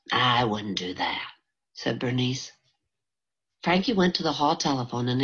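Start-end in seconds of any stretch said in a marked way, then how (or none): 0.1-1.3 a low, slow, steady reply from the cook; lightly voiced older woman, calm and firm; Bernice's lower voice. A narrator's reading.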